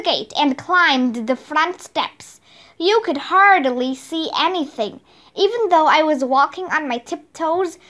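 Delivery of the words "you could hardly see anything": usual speaking voice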